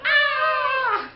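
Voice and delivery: high startled shriek; scared scream. Acted. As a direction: shriek like the fleeing people. Riddle creature sends people running.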